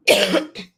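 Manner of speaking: short rough cough; real cough, then carry on; coughing fit. Real, not acted. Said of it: "I'm coughing so bad".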